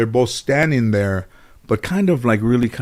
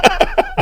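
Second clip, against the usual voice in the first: breathy laugh. Real, not acted. Laugh after joke.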